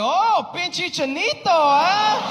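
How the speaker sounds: high, slow mocking Spanish phrase; Mexican accent. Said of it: "He was like".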